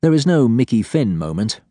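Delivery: ordinary delivery, nothing marked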